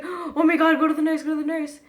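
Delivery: high, loud, fast panicked orders; mock panic as she finally sees the blood; alarmed teacher. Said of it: "She's like".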